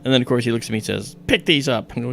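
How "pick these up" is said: mock stern order. Angry chief orders boy to clean.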